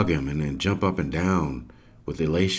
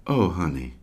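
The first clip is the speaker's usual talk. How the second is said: sweetly. Retold or imitated. Mother soothing her eager daughter in a sweet whisper. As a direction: gently hush a child. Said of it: "Angela sweetly says to her".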